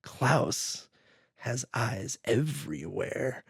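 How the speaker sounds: hushed, low, slow mock menace; eerie hushed warning, drawn out; ominous scare. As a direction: imitate someone spooking a kid, low and soft. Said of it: "scares the kid by saying this".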